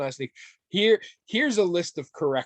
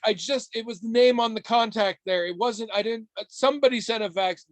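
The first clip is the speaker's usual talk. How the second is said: flustered; 9 semitones higher than usual. Retold or imitated. Retold own flustered reply.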